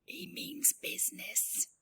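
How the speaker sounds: whispered aside. Real, not acted.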